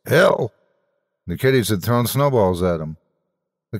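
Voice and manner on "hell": ironic gentle call. Acted. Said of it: "in a gentle voice".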